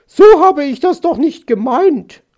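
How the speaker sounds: high, loud magpie squawk; indignant protest. A narrator's reading. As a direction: protest loudly, stung by the pinch. Magpie protests after being pinched. (lauter, empörter Protest)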